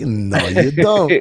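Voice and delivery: put-on French sliding into Mexican accent; mock foreign accent, drawn out at the end; muddled cartoon accent. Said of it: "almost like Pepe Le Pew and Speedy Gonzalez".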